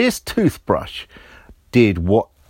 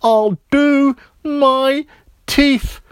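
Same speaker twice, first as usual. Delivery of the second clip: put-on very high, louder child voice, slow at the end; boy giving up; 13 semitones higher, 6 dB louder, slower than usual. A narrator's reading. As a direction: surrender to the toothbrush. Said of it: "Roger said".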